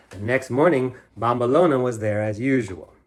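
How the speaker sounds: plain reading voice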